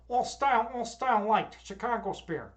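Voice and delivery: mock South Side Chicago accent. Shown as a demonstration. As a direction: ham up the Chicago vowels. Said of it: "That's how we talk".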